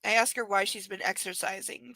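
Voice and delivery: normal talking voice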